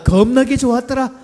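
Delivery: emphatic Jeolla dialect demo. Shown as a demonstration. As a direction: belt out 겁나게 in Jeolla style. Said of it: "전라도 버전입니다".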